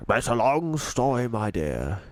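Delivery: put-on gentle old tramp voice, a different timbre; old tramp Mister Stink. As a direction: speak like a kindly old vagrant.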